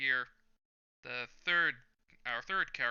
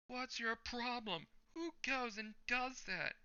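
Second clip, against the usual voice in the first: much higher, slow, outraged squeal; shocked thug; 13 semitones higher, slower than usual. Acted.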